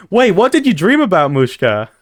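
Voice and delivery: ordinary delivery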